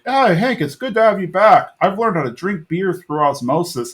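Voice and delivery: high, goofy buddy voice; a dim friend proud of a silly trick. Retold or imitated. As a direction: Dale greeting Hank at the alley in a high, goofy voice. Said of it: "Dale's like".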